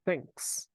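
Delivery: thanks with th made by the tongue between the teeth; thanks as a tongue-between-teeth th demo. Shown as a demonstration. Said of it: "Na hora que você pronunciar o T H".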